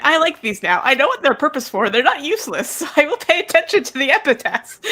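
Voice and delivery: newly convinced fan, laughing it out; excited resolve. Retold or imitated. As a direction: reader promising to pay attention, laughing.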